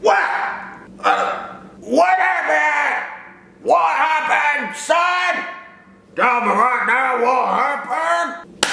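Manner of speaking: hammed-up dad shouting; dad arriving at the flood, shouting; mock shouting dad. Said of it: "he's like".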